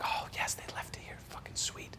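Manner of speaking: very soft whispered little celebration; hushed relief; relieved whisper. Retold or imitated. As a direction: whisper it to yourself, pleased. Finding key while sneaking in.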